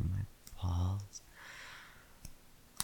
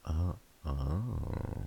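normal talking voice

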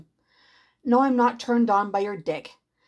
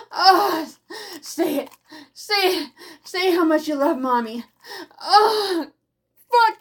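passionate moaning; 5 semitones higher than usual. Acted. Building to climax, moaning.

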